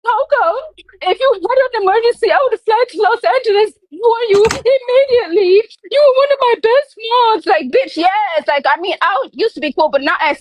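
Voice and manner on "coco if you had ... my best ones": high-pitched imitation of tearful pleading; mock crying. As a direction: mimic someone crying, pitched way up. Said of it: "like, crying about me".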